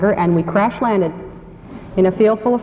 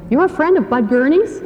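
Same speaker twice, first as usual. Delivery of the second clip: higher, rising retold question; surprised question; 8 semitones higher than usual. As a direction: mildly imitate sudden surprised interest. Father reacts to hearing friend's name.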